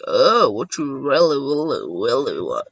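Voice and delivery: low, slow drawled mock voice; acted taunt. Player mimicking a taunt to opponents, deep slow mocking drawl. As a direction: drop deep and drag out the words. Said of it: "I'll be like".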